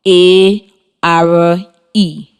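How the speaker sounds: letters F O R spaced slowly; slow spelling dictation; spelling aloud. Shown as a demonstration. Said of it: "it is spelled".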